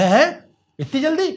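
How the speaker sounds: high, loud, slow astonished gasp; stunned disbelief; amazed. Acted. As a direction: Tinku amazed Krishna is back so soon, high and slow. Boy amazed at Krishna's speed.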